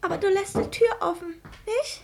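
laut und höher, drängend; ängstlich bittend. Acted. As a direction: sichere dich ängstlich ab. (krankes Mädchen bittet den Onkel, die Tür offen zu lassen)

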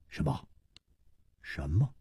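hushed, halting, baffled questions; stunned disbelief. A narrator's reading. Song Gang learns only a letter is wanted, quietly stunned. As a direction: repeat it softly, unable to believe. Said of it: "宋刚不禁愣了愣".

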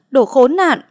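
mother loses control and screams; screaming insult. Acted. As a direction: break into a full scream on "đồ khốn nạn". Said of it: "Mẹ anh thấy vậy mới hét lên".

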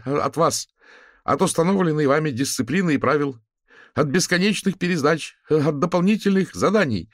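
high, loud ringing student voice. A narrator's reading. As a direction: ring out across the square. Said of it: "разнёсся звенящий голос".

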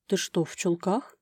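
a touch of stunned surprise; stunned exhale. A narrator's reading. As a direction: breathe out the question, mildly astonished. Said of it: "Потрясённо выдохнул он". (потрясённо, на выдохе)

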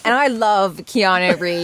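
usual speaking voice

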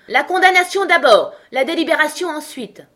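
high, loud imperious shout; crying out. A narrator's reading. The queen demands the sentence first, crying out. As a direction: shout the royal decree at high pitch. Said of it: "s'écria la reine".

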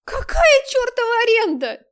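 high, loud raging yell; furious scream. Acted. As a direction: shriek with outrage. Nastya raging about the lease.

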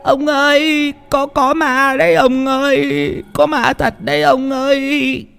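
high, stammering whimper; frightened mumbling. Acted. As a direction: insist fearfully in a drawn-out high voice. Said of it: "mà lẩm bẩm".

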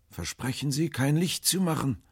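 very high, anxious request; pleading call. A narrator's reading. Stranger asks the sailors for darkness, high and pleading.

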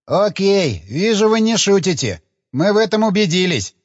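drawling syllables. A narrator's reading. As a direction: stretch every syllable out lazily. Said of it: "растягивая слоги".

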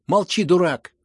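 high, very loud order; angry shouting. A narrator's reading. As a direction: yell to silence your brother. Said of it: "закричал Коля".

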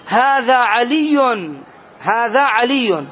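slow, deliberate هذا علي with a doubled ي; slow careful example. Shown as a demonstration. Teacher slowly models هذا علي for the class, deliberately. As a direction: spell out علي slowly, leaning on the ي. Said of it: "ونقول".

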